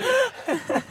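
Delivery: a high, light laugh; real giggle. Real, not acted. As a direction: giggle at own remark. Laughing at own joke.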